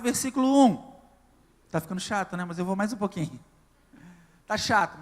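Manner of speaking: loud, driving pulpit call-and-response; full-force preaching. Acted. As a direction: hammer each verse at the congregation. Preaching run through the chapters. (pregação em voz alta e inflamada)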